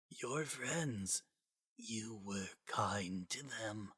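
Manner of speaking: faint, slow breathy near-whisper; barely there, fading away; a dying voice, soft and distant. A narrator's reading. Gaster's dying voice.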